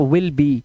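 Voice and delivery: will be said as "विल बी", both words clear; will be pronounced. Shown as a demonstration. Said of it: "ऐसे पढ़ेंगे इसको". (will be को "विल बी" पढ़िए)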